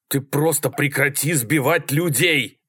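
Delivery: yelling. A narrator's reading. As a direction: yell it at the driver. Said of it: "заорал Малькольм". (возмущённый крик)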